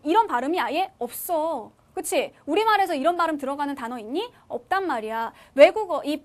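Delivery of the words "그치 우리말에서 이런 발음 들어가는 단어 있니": ordinary delivery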